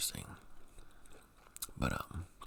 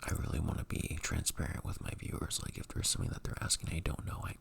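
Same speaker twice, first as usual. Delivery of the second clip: soft ASMR whisper; breathy whisper to the mic; 4 dB quieter than usual. Real, not acted. Whispers all through.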